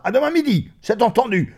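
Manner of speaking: high, loud, emphatic; boisterous farewell; hearty parting shout. Acted. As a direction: fire it off to seal the appointment.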